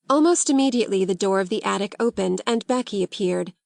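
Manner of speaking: ordinary delivery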